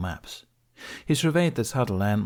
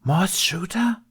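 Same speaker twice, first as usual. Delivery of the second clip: slow, higher, breathless whisper of alarm; hissed whisper; 12 semitones higher, 3 dB louder, slower than usual. Acted. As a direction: hiss "Mos Chuta?" in a shocked whisper. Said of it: "Terran hissed".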